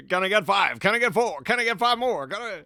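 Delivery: high, loud auctioneer patter. Retold or imitated. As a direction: rattle off bids like an auctioneer. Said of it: "Lane Smith doing the auctioneer bit".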